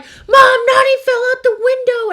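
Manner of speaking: shrieking high and loud in panic; panicked scream. Retold or imitated. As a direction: scream it in sheer alarm. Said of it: "I was, like, freaking out".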